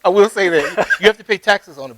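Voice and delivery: laughing through the words. Real, not acted. Guest admitting to the host that the award is taxed, laughing. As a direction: chuckle while conceding the catch.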